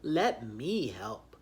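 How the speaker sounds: slow, breathy big-beast voice; a hint of a heavy old muskox; lightly voiced muskox. Muskox offers help, lightly voiced slow. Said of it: "he says".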